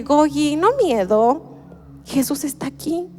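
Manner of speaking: lightly voiced toddler soothing her brother; imitated calming child. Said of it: "ella calma a su hermano y le dice". (imitando levemente a una niña que calma a su hermano)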